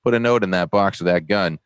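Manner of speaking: high-pitched South Boston cop giving instructions; South Boston accent. Retold or imitated. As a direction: imitate a South Boston police sergeant. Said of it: "South Boston, right down to his toes".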